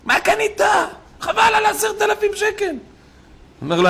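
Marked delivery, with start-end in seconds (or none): 0.0-2.8 very high-pitched mock scolding; exaggerated, high-pitched busybody's complaint; imitated heckler. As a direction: squawk the reproach in a put-on high voice. Acted heckler berating a buyer.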